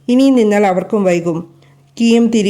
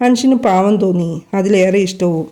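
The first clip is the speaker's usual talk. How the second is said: ordinary delivery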